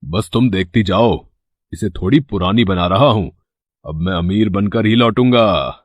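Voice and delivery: low, scheming voice; चालाक इरादा. Acted. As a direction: confide a greedy plan in a low pitch. Greedy brother reveals his scheme. (नीची, चालाक आवाज़)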